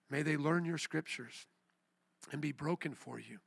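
soft, subdued prayer of repentance; low-voiced plea to God; solemn prayer. Real, not acted. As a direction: pray quietly, with long pauses.

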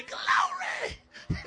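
"Glory" yelled high as "glaw-ray"; hammed-up preacher shout. Pastor getting congregation to repeat "Glory", high hammed-up shout. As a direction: belt out "Glory" very high, ending in "-ay".

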